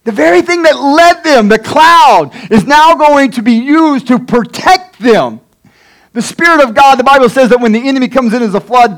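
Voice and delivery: high, emphatic preacher's proclamation; impassioned preaching. Acted. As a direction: proclaim it with rising fervor. Preaches at full force.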